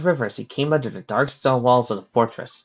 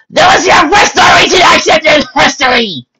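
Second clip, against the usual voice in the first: high, full-volume shouting; furious shout; 14 dB louder than usual. Acted. Trixie shouting about the worst story, loud high shout. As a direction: shout it at the top of your range. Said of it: "shouted Trixie".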